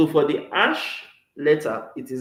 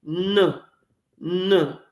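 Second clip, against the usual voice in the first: ordinary talk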